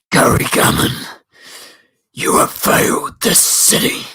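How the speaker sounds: loud rasping Arrow voice, breathy and menacing; Oliver Queen impression. Shown as a demonstration. For the stream chat, doing a bad Oliver Queen voice. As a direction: growl the line like a vigilante hero. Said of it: "That's my worst Oliver Queen impression".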